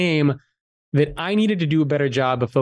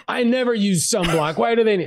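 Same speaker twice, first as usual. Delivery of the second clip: higher-pitched mock protest as Dad; 7 semitones higher than usual. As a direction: imitate a stubborn dad arguing back. Dad argues back in retold exchange.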